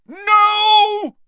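long, high, full-force roar; Snape. A narrator's reading. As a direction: roar a halt to the attack. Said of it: "roared Snape's voice".

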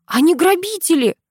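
high, urgent protest; agitated outcry; alarmed accusation. A narrator's reading.

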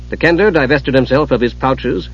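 plain reading voice

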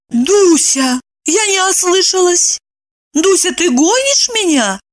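high, lost questioning; bewildered. A narrator's reading. Eva can't believe she's being thrown out, bewildered. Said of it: "растерянно прошептала она".

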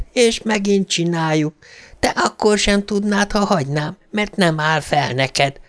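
high-pitched mocking girl's voice; teasing ghost girl. A narrator's reading. Ghost girl belittling Harry in the field, teasing. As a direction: taunt him, breaking into mocking ha-ha laughter.

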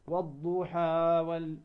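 والضحى with the final vowel dragged too long; wrong overlong madd. Shown as a demonstration. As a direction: overstretch والضحى to show the error. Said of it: "لا، خطأ".

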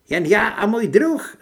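high, loud female voice; high-pitched yelled answer; woman shouting. A narrator's reading. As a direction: shout it back as a hostile woman. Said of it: "zakřičela na mě žena".

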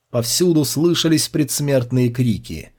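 usual speaking voice

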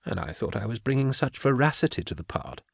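quiet, dry self-mockery; soft aside, pleased with the act; murmured wry joke to Kara; softly. A narrator's reading. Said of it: "Karl said softly".

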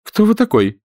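high, startled woman's question; a lady's startled demand; astonished. A narrator's reading. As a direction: voice her sudden shock at the stranger. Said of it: "изумленно спросила она".

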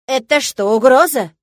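loud, indignant surprise; old teacher shocked by the student's threat; shocked old lady. A narrator's reading. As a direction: gasp out the question, scandalised. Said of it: "удивилась старушка".

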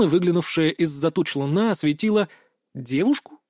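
plain reading voice

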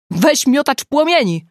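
loud, high excited call. A narrator's reading. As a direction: shout the request down the phone. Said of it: "zawołała Agata".

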